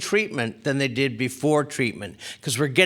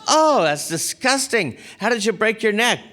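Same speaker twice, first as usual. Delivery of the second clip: mock disgust; 6 semitones higher than usual. Woman hearing about bolts in skull, mock disgust. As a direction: stretch the "oh" in imitated disgust.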